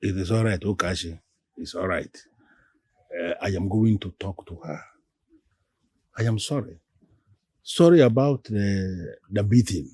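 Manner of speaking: calm apology. Acted. Man apologising to angry woman for beating, calm. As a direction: apologise gently and unhurriedly to placate.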